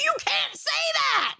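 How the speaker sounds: high-pitched, drawn-out shout; exasperated scolding. Real, not acted. Host tells Albert off for threatening to dox, exasperated shout. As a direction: cry it out in high, slow protest.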